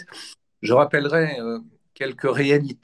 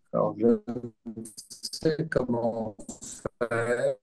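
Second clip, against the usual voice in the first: choppy dropping connection; corrupted glitchy audio; 7 dB quieter, slower than usual. Real, not acted. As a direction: voice breaking up over a bad line. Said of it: "le son saute beaucoup".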